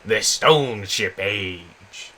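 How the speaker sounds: loud, slow showman welcome; announcer intro. Acted. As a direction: boom the channel opener. Channel intro greeting.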